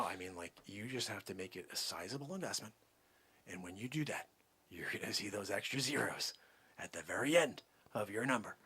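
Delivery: low, very soft sales talk; hushed pitch. Acted. Salesman coaxes Joe Ellen to invest, hushed. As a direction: keep it down, just between us. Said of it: "I have to keep my voice down".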